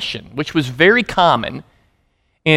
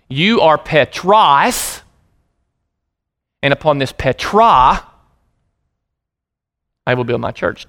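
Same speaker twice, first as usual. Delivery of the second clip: slow Greek declaration; slower than usual. Shown as a demonstration. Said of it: "Here's what Jesus would have said had he been speaking Greek".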